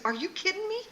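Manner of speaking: high, incredulous husband imitation; mock incredulous. Husband protesting they are in his parents' house, imitated disbelief.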